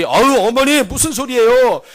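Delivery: acted son jumping in, pitched high; protesting son. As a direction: object earnestly to mother's apology. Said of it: "옆에 있던 아들이".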